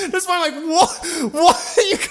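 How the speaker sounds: very high, helpless laughing voice; cracking up. Real, not acted. Storyteller replays his shock at the cocaine, cracking up. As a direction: squeak the question out through laughter.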